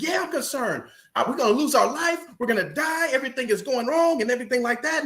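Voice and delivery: high, quick panicky wife voice; frightened wife. Retold or imitated. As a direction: rush it out like a wife sure they'll die. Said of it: "She said".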